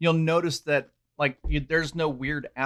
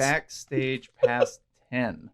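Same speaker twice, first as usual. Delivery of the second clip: slow; much slower than usual. Shown as a demonstration. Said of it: "promo code for listeners".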